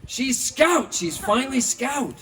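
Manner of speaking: thrilled high shout; loud, high, delighted cry; excited. Retold or imitated. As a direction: burst out high and loud with joy. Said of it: "He goes".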